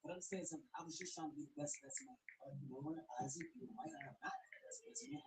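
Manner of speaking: usual speaking voice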